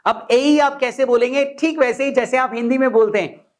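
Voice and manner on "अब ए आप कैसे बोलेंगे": normal talking voice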